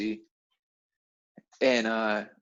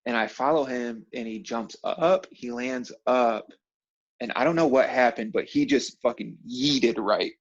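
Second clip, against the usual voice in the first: usual speaking voice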